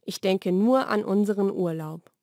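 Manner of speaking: ordinary delivery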